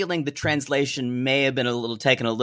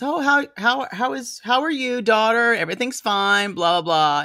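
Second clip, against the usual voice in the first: much higher sweet mock mother; a high, chirpy mother imitation; 7 semitones higher than usual. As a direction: ham up a chirpy mom. Voicing Hazel's mom.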